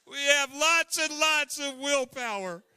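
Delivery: booming repeat. Shown as a demonstration. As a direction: announce it proudly to the room. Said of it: "but we have lots and lots of willpower".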